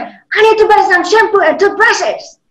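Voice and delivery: teacher demoing an angry line. Shown as a demonstration. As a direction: sound really angry at the shop. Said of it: "your lines in an angry tone".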